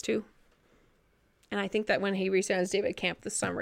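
ordinary delivery

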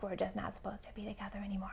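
quick, under-the-breath imagined verdict; hushed resigned conclusion. Acted. Acts out silent resentful conclusion.

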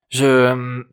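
halting, held-out filler; hesitant false start. A narrator's reading. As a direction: falter as if searching for words. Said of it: "hésita-t-il".

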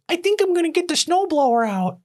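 high mocking voice of the neighbor. Mimicking the neighbor telling her boyfriend, high and mocking. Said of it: "she goes".

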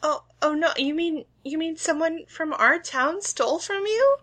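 high, gentle shop clerk; sweet small-town cashier; shopkeeper voice. Acted. GM voicing shopkeeper Shirley.

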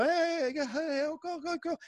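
high call from a window. Retold or imitated. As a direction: hail the passing truck. Neighbors calling from windows.

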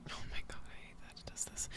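fast whispered complaint; whispered aside. Real, not acted. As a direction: mutter it under your breath, quickly. Muttering aside into the mic.

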